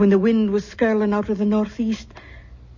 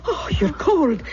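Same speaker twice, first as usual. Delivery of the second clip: concerned surprise; 7 semitones higher than usual. Acted. Aunt notices niece shivering.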